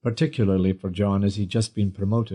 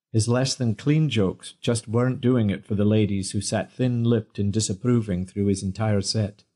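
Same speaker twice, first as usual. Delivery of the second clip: usual speaking voice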